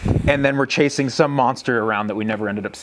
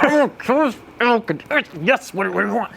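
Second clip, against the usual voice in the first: mock-exasperated, higher-pitched answer; retold rage; 8 semitones higher than usual. Ticked-off hunter answering his buddy's call, mock rage. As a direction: answer as if fed up with being interrupted. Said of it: "I'm, like, all rage caged".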